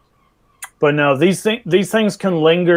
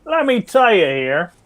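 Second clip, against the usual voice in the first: put-on drunk Australian accent; 4 semitones higher than usual. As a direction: play a tipsy Aussie bloke. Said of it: "That sounded like a drunk Australian".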